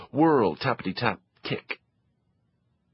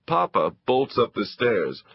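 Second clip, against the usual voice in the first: ordinary delivery, nothing marked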